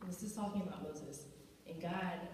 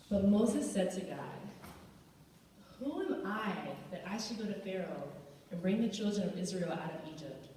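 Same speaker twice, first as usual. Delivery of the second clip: raised, emphatic scripture reading; 3 dB louder than usual. Acted. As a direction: proclaim the verse with rising force. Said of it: "Verse eleven says".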